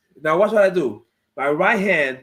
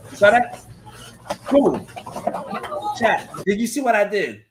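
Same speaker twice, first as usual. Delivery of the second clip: excited; 4 semitones higher than usual. Real, not acted. Fighter thrilled to show chat his takedown, excited. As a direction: pump it up like a proud fighter.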